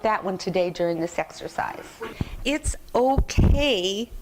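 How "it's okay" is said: slow, drawn-out soothing "okay"; slow reassurance. Real, not acted. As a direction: stretch it out kindly to reassure. Reassuring about internal dialogue.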